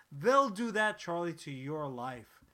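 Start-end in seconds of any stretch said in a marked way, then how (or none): none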